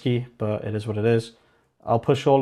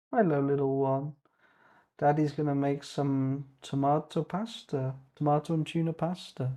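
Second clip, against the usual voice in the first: cooing to cat; 3 semitones higher, 5 dB quieter than usual. Real, not acted. A man telling his cat about dinner in a soft coo. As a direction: coo gently like talking to a pet.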